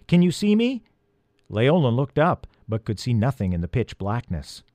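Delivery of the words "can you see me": high, mildly nervous call; calling, slightly trembling. A narrator's reading. Peter calls down to the trapped elf, nervous call. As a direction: call down with a touch of unease. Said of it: "his voice trembling a little".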